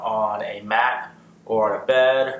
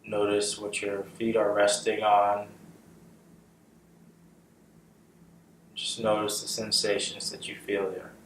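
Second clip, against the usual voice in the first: guided meditation; 3 dB quieter than usual. Acted. Guiding listeners' awareness up from the feet, calm meditation voice. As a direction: lead them gently through the body, unhurried. Leads a body scan meditation.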